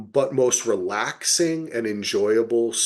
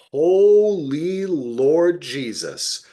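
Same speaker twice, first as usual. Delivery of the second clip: slow, louder, drawn-out "Holy Lord Jesus"; awed exclamation; 5 semitones higher, 5 dB louder, much slower than usual. Real, not acted. Gushing to co-host about the actress, slow and awed. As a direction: stretch it out in mock worship.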